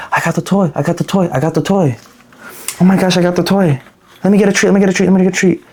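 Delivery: high, eager puppy babble; excited dog. Acted. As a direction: beg like an overexcited dog, repeating it in a high voice. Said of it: "You look like a dog that's coming up".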